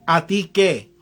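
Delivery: ordinary talk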